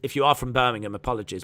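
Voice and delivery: ordinary talk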